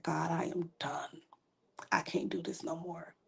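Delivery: unvoiced, broken whisper of surrender. Answering God on the floor after the pills, whispered. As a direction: breathe the words out with nothing left. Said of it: "And I said".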